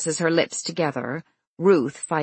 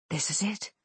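hushed; 2 semitones higher, 9 dB quieter, faster than usual. Acted. Tense moment before door.

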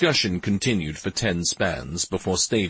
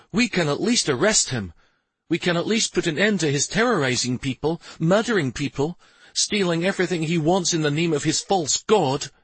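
mildly heated, higher-pitched insistence; rounding on her; 9 semitones higher than usual. A narrator's reading. Husband rounding on his wife about arresting the priest, mildly heated. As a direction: press the case with understated anger. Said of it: "Stavin rounded on her and said".

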